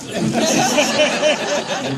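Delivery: a big high burst of laughter; hearty laugh. Real, not acted. Laughing at recalling the casting question. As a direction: laugh out loud at the memory.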